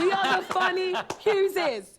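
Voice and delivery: high, slow, laughing mock pride; mock family pride. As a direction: ham up the family motto with a laugh.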